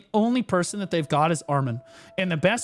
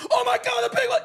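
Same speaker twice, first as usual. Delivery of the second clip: high, loud hype-caster yell; hype-man shout; 6 dB louder than usual. Pretend. Caster pretending to be the background hype man, shouting. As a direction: blast it like the big hype guy behind the desk.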